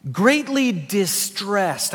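"distressed" drawn out slowly; slow it right down on "greatly distressed"; slow emphasis. Shown as a demonstration. Pastor reading scripture, slowing to stress "distressed". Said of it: "underline the word distressed".

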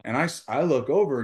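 ordinary talk, nothing marked

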